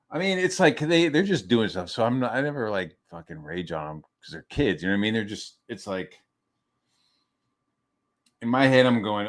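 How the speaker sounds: ordinary talk, nothing marked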